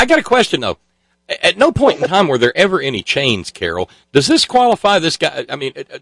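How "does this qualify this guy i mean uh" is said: ordinary delivery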